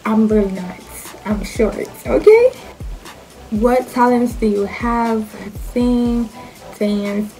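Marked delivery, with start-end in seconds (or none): 2.1-2.5 high sassy okay. Real, not acted. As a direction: flip the okay up high and loud. Emphatic tag question.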